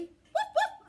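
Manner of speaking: two high, loud woofs; dog barks. Acted. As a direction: answer in short high barks. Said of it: "I say".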